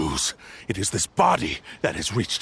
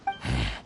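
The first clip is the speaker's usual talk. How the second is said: low, soft exhaled sigh; weary sigh; 12 semitones lower, 6 dB quieter than usual. Acted. Blade brushing off the accusation, soft sigh. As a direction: let out a soft dismissive sigh. Villain sighing before answering.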